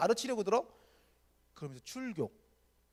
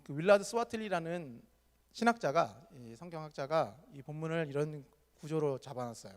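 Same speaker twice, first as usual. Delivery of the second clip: ordinary talk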